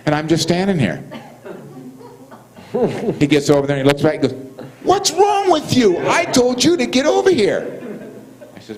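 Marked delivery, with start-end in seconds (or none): 4.8-7.8 very high imitated guard yell; mock an outraged order, pitched way up; exasperated yell. Guard calling the inmate over, acted exasperated yell.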